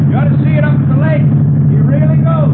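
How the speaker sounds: loud, high voice over a roaring motor; car owner shouting over his revving hot rod. Acted. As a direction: shout to be heard over the engine. Talking over revving engine.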